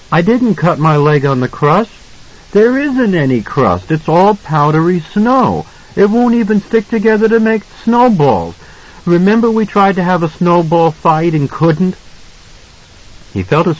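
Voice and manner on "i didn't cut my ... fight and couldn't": put-on high-pitched child's protest; insistent small boy. A narrator's reading. Boy contradicts his father's explanation, high and insistent. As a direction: argue your case as a little boy.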